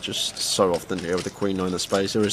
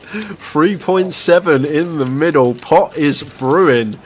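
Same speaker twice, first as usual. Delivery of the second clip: higher, louder, slow and drawn out; excited commentary; 7 semitones higher, 8 dB louder, slower than usual. Real, not acted. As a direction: build the drama of the pot. [name] calls into big pot.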